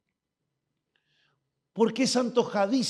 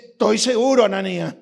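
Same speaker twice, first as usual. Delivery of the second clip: firm Lord; 8 dB louder than usual. Acted. The Lord answers firmly.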